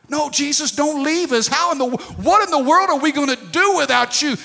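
pleading disciples. Acted. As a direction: voice the disciples begging Jesus to stay. Disciples beg Jesus not leave.

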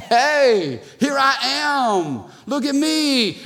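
high, show-off call for attention; mock boastful; proud voice. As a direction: preen like someone craving a spotlight. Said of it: "pride creeps up and we say".

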